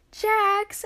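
high wailing name; despairing wail. Acted. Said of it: "Ash wailed".

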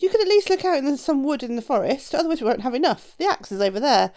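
a hint of an impatient giant; bossy giant. A narrator's reading. Giant sending the shoemaker for wood, lightly bossy.

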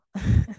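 acted villain's chuckle; gloating snake chuckle. Snake looking at its dying victim, gloating chuckle. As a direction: chuckle darkly as the watching snake.

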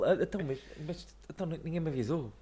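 high, puzzled mock whine; confused Bottas voice. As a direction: act caught off guard. Imitating Bottas confused at start.

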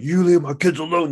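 stern dad. Retold or imitated. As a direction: warn the rooster off. Dad threatening rooster.

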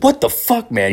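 high-pitched disbelieving reaction; mock being appalled at what you just heard; high, incredulous protest, acted out; retold shock. Shocked reaction to uncle's dark remark.

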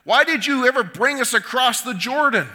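anguished plea to God; crying out to God. Acted. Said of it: "Joshua cries out to God".

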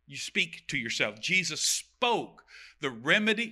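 ordinary talk

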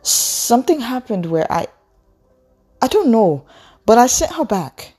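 put-on high, halting girl's voice; faltering girl. A narrator's reading. Sunny tries to explain to Orlu, high halting voice. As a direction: break off and restart, pitched high.

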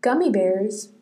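ordinary talk

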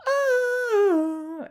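high, loud squealing joy with laughter; delighted squeal. Real, not acted. Vlogger reacting to hold music, squealing with joy.

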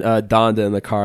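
usual speaking voice

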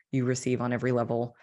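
ordinary talk

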